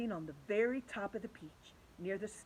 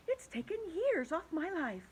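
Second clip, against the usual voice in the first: ladybug voice; 9 semitones higher than usual. Acted.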